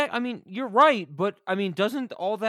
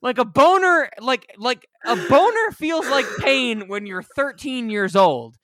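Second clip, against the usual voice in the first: mock exasperated; 6 semitones higher, 5 dB louder than usual. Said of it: "And I'm like".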